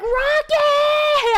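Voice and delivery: high, loud yelled name; retold scream. Streamer retells screaming at her cat, loud and high. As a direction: shout the cat's name across the house. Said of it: "I was, like, screaming".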